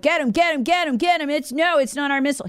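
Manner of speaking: a hammed-up panicky cry to get him; mocking Zelensky. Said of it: "And Zelensky's like".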